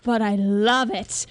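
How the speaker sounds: drawn-out theatrical British; British accent. Acted. Son teasing mother about her play, slow British accent. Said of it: "we can do British".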